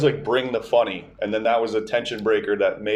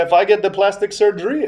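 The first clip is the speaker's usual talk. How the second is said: high, louder attention-seeking call; insistent grandma; 8 semitones higher, 4 dB louder than usual. Retold or imitated. Grandma retries her joke on distracted grandpa, insistent imitation. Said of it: "And then she's like".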